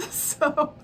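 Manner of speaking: high, slow, through laughter; laughing. Real, not acted. Telling viewers it was hilarious, laughing. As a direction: laugh as you say it.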